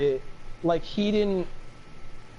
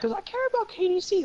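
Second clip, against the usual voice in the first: much higher, mock earnest; 14 semitones higher than usual. Real, not acted. Defending himself, says he cares in a mock earnest voice. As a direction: overplay the caring. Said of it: "mock sincere".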